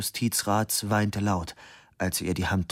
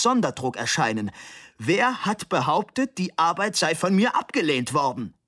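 mounting indignation; 5 semitones higher than usual. Acted. Professor defends his verdict to the parents, rising to a high outraged pitch. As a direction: build from firm to near-shrieking outrage.

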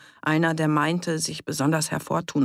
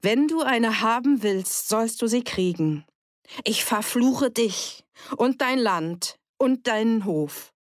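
höher und lauter, ein Fluch voller Zorn; wütender Fluch; 7 semitones higher than usual. A narrator's reading. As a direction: verfluch ihn und sein Land, bebend vor Wut. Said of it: "Sie war laut geworden". (Edith verflucht Fritz, laut und zornig)

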